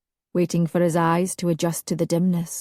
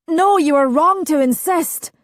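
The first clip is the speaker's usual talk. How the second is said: raised plea; 10 semitones higher, 6 dB louder than usual. A narrator's reading. Alistair pleading in dream.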